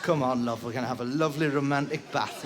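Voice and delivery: low, soft romantic come-on; mock seductive husband. Husband luring his wife into a bath, low romantic murmur.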